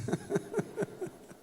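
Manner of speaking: high, breathy run of chuckles; amused laugh. Real, not acted. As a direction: laugh out after your punchline. Laughs at the punchline.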